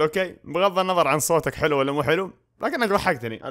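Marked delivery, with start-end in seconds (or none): none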